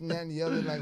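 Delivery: ordinary delivery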